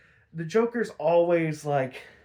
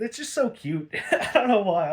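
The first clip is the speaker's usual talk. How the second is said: giggling through the words; laughing; 5 semitones higher, 3 dB louder than usual. Real, not acted. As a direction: laugh it out, amused. Laughing about cute costumes.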